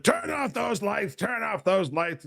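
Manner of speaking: high, slow mock Trump rant; angry Trump. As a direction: bark it like an irate Trump. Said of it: "angry Trump".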